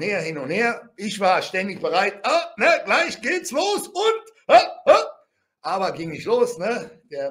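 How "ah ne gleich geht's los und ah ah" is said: high, loud, tense re-enacted readiness; mock battle-ready anticipation, pitched up; poised to fight. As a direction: build to high fighting yelps. Acts being poised to fight.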